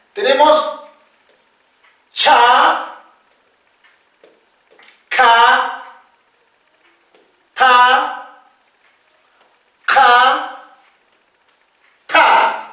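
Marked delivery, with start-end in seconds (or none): 2.1-12.6 "cha, ka, pa, ka, ta" with a strong puff after each consonant; aspirated consonants. Shown as a demonstration. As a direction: breathe out through each onset, long pauses between. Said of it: "Las consonantes espirales". (consonantes aspiradas, bien separadas)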